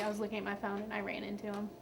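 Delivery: ordinary talk, nothing marked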